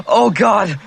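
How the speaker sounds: anguished cry. Acted. Boy watching the ship pass, crying out in despair. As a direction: cry out in dismay.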